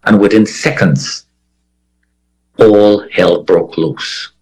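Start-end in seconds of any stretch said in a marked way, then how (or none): none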